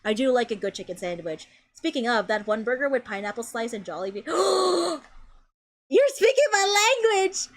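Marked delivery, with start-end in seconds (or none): 4.3-7.5 real excited gasp, then high-pitched delight; light up at a shared favourite, pitch leaping; thrilled. Real, not acted. Thrilled at mention of favourite burger.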